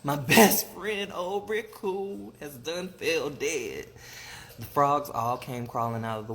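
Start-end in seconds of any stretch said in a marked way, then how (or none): 0.0-3.9 high, slow grieving wail; hammed-up tearful keening; mock crying. A narrator's reading. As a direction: lament it in drawn-out mourning. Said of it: "he cried".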